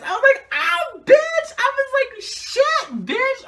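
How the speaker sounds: high, loud, squealing excitement; excited scream. Retold or imitated. Fan reliving the moment the singer was announced, high excited shriek.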